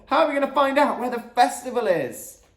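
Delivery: high, loud whining wail; howled protest. A narrator's reading. Gamble pleads about finding the festival, howling. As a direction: wail it out, desperate to know. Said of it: "howled Gamble".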